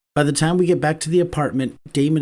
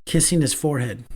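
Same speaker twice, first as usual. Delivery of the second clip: ordinary delivery, nothing marked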